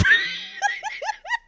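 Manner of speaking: high, wheezy burst of laughter; helpless high laugh. Real, not acted. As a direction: crack up after your own bit. Cracks up after own impression.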